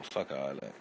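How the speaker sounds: normal talking voice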